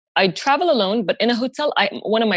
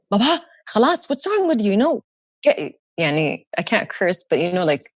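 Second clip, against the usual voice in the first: exasperated scolding. Retold or imitated. Scolding panicking father.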